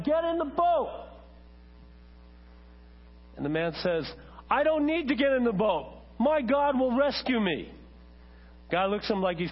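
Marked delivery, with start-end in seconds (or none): 0.0-1.1 high, drawn-out yell; acted yell. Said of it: "he yells out to the man". 4.5-7.7 high, insistent shouted refusal; defiant shout. Acted. Acted believer shouts back to rowboat.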